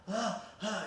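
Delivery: high, winded "ah, ah"; re-creating gasping after breaking a rib; pained gasps. Retold or imitated. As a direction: gasp it out as if the wind is knocked out. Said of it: "I was trying to catch my breath".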